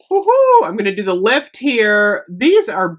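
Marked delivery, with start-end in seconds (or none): 0.1-0.6 high, loud woohoo of delight; real thrill over new candles; excited whoop. Real, not acted. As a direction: cheer it out loud. Cheering at her candle haul.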